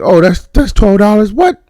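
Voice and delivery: high, loud shocked squeak on the price; hitting the roof over a twelve-dollar coffee; incredulous disbelief. Retold or imitated. Scott Lang balking at the coffee price, high and disbelieving. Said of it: "Scott Lang was like".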